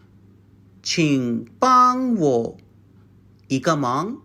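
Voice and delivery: 请帮我 drawn out slowly for the class; slow model phrase. Shown as a demonstration. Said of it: "You just added 一个忙".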